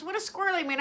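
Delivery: high-pitched retold puzzlement; baffled question. Retelling his repeated baffled question.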